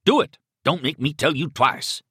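high, loud burst of command; barked order. Acted. Killer presses hesitating captive, barking. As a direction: snap the order out impatiently. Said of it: "he barked at Miriam".

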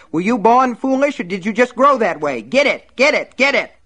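loud high furious shout; furious yell. Acted. Buster screams orders at his wife, loud furious yell. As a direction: bark the order at her.

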